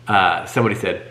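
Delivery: ordinary delivery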